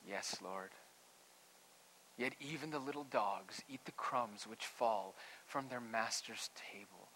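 soft, humble reply, trailing to near whisper; respectful. A narrator's reading. Woman answers her Lord after rebuke, soft and respectful. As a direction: answer softly and deferentially. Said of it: "How respectful is she?".

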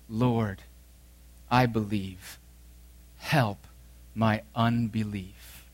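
quiet, slow, tearful father's prayer; tearful plea. Acted. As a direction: let the tears soften each word. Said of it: "said with tears".

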